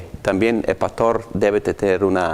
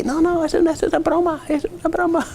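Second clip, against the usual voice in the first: much higher, squeaky prankster excuse; mock excuse; faster than usual. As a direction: play the sheepish joker brushing it off. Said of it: "Oh, tan solo estaba bromeando".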